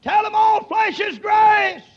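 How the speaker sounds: slow, high, loud shout, stress on all; thundering proclamation. Real, not acted. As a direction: proclaim it like a voice from heaven. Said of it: "The voice that the prophet heard said".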